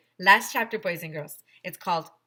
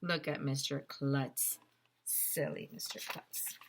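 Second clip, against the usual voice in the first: teasing aside; 4 semitones lower, 5 dB quieter, slower than usual. A narrator's reading. Aside, teasing, slow and soft.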